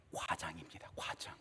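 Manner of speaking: breathy hushed reveal; dramatic whisper. Acted. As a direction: drop to a whisper for effect. Dramatic reveal of third point.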